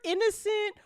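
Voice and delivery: ordinary talk, nothing marked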